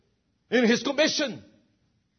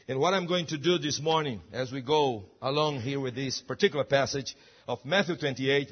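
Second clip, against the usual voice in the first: normal talking voice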